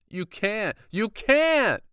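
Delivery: rising to a high, loud shriek. Acted. Boy shrieking at the doctor who wants his hands. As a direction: shriek in horror. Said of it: "I shrieked".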